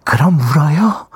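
slow cutesy pleading to the boss; put-on cute voice, drawn out; cute back talk. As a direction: play a mock-tearful, adorable subordinate. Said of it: "한번 귀엽게".

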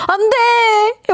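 playful protest. Real, not acted. Host protests listener's complaint.

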